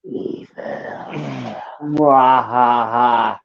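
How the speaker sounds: low, slow evil cackle; mock villain. Host riffing on evil-lution, hammed-up villain voice. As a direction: creep in softly, then a slow villain laugh.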